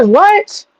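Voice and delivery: high, shocked "what"; recalling her own disbelief at the news. Retold or imitated. Telling the story to chat, a shocked high "what". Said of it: "I said".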